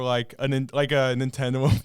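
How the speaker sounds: ordinary talk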